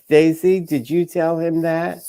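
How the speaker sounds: ordinary talk, nothing marked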